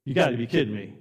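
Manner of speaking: usual speaking voice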